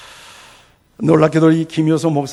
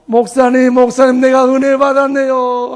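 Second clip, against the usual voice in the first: loud, higher, mock-wailing exclamation; imitated tearful cry; 5 semitones higher, 9 dB louder than usual. Old woman tells pastor she was blessed, imitated loud tearful cry. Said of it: "하면서 엉엉엉 울어요".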